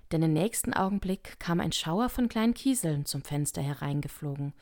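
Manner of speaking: ordinary delivery, nothing marked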